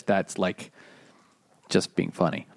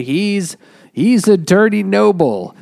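louder, higher accusing cry; mock accusing shout; 8 semitones higher, 7 dB louder than usual. The court crowd denouncing Darnay, mock accusing shout. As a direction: shout it like a crowd condemning him.

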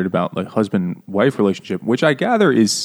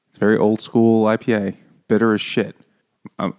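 ordinary talk

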